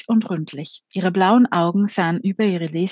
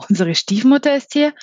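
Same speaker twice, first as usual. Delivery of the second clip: überrascht; 7 semitones higher, 5 dB louder than usual. A narrator's reading. Alec hört von Stiefmutters Ankunft.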